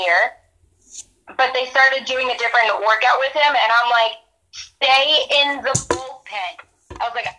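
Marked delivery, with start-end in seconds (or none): 4.5-7.0 very slow, each word drawn out; emphatic retold warning. Friend warning an injured pitcher, slow and insistent. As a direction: spell it out slowly, as if for someone not listening.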